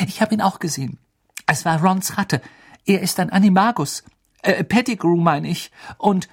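high, earnest pleading; eager to be believed; urgent insistence. A narrator's reading. Girl insisting on what she saw.

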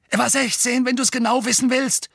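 high, indignant comeback; defensive bully snapping; snapped retort. A narrator's reading. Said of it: "fauchte Dudley".

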